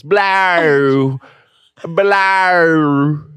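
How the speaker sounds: "Blow" stretched into a long "blaow"; low, drawn-out Australian "Blow"; triphthong demo. Shown as a demonstration. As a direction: draw "Blow" slowly through three vowel sounds. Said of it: "it's all trip thongs".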